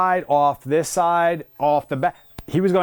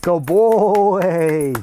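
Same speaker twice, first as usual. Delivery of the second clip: sing-song dog praise; 3 semitones higher, much slower than usual. Real, not acted. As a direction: gush over the dog like he's a puppy. Trainer praising the dog warmly.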